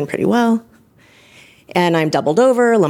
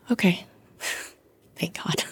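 relieved whisper; 10 dB quieter than usual. Real, not acted. Relief after the diagnosis.

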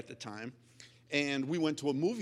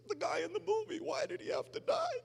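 much higher, hammed-up wailing quote; wailing girlfriend; 4 dB quieter, faster than usual. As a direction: wail it like a heartbroken girlfriend. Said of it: "And she says".

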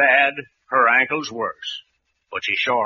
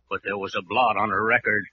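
ordinary delivery

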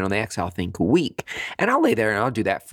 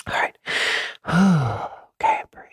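whispered self-coaching around a big sigh; hushed, airy reset; self-calming; 6 semitones higher than usual. Acted. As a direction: breathe out and whisper to settle yourself. Acting out calming herself to meditate.

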